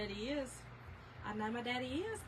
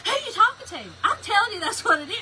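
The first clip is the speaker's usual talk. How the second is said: higher and louder, snapping back at him; retelling how she fired back; heated argument; 7 semitones higher, 8 dB louder than usual. Retold or imitated. Arguing with her husband over the car noise, heated and loud.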